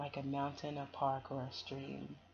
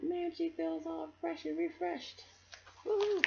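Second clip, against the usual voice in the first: high, playful whoop; excited cheer; 13 semitones higher, 3 dB louder than usual. Retold or imitated. Reader playfully voicing the bikers' thanks, high excited whoop. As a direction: voice a kid cheering. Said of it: "they're thanking him like".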